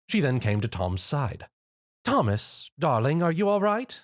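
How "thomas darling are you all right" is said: concerned. A narrator's reading. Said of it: "she asked with concern".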